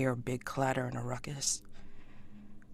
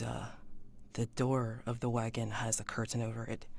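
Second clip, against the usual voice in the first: ordinary talk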